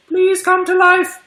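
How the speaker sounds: very high little pleading voice; squeaky mock plea. Owner pleading with unhatched triops eggs, squeaky mock begging. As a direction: beg in a tiny, high, drawn-out squeak.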